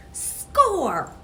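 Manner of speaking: loud, high victory shout; triumphant cheer. A narrator's reading. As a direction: shout it out like you just won. Cheer after brother laughs.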